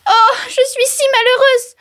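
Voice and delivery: very high wailing lament; high-pitched acted misery; distressed outcry. A narrator's reading. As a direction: wail like a storybook wife in despair. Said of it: "s'écria-t-elle".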